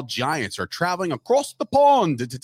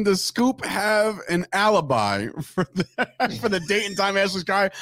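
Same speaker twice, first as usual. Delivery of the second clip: ordinary talk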